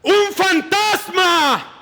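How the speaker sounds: high, slow frightened cry; terrified shout. Acted. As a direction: scream it out like terrified sailors seeing a ghost. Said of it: "Llenos de miedo, gritaron".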